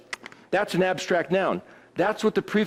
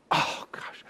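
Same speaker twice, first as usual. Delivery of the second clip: breathless whispered oh gosh; whispered dismay; 8 dB quieter than usual. Real, not acted. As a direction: let it slip out under your breath. Aside after the verdict.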